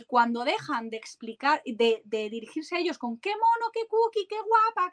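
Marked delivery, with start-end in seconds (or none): 3.2-4.9 put-on high sugary cooing at a toddler; voz de adulto a niña. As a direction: coo like a doting grown-up praising a cute girl. Adults cooing at little girls. (voz aguda y melosa de adulto que halaga a una niña)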